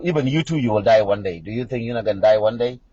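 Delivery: ordinary talk, nothing marked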